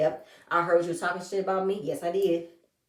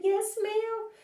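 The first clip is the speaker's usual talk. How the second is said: much higher, mock-polite sing-song; sassy yes ma'am; 14 semitones higher than usual. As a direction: toss it off with cheeky fake politeness. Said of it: "I said".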